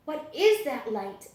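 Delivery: plain reading voice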